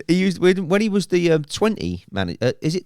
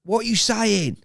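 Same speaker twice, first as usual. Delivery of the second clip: retold shout; 9 semitones higher than usual. Man answering partner upstairs, retold shout. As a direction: call upstairs without real volume. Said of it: "I used to shout out".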